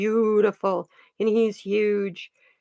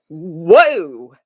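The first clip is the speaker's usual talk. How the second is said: awed whoa; 4 semitones lower, 10 dB louder than usual. Retold or imitated. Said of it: "I was like".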